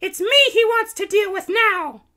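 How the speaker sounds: very high, loud cartoon heroine; triumphant princess. A narrator's reading. Princess Kitty on who the Baron wants, triumphant. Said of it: "she said in triumph".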